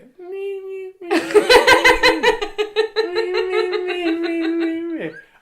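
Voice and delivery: high, loud whiny mimimi mockery; mock his voice with a nasal mimimi. Teacher mocking the actor's voice with high loud mimimi. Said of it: "he'd just go".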